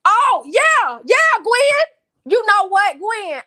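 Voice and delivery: loud, high-pitched call to Gwen. Real, not acted. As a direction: pitch it up and holler in agreement. Calls out in reply to Gwen.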